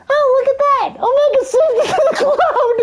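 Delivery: high-pitched, giggly sidekick voice; Tails impression. Tails tells everyone Omega saved it, high laughing voice. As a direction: chirp it high, breaking into laughter. Acts Tails announcing the cloud save.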